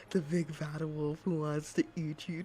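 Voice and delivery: mock-menacing big bad wolf. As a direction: savor the threat like a hungry wolf. Wolf boasting.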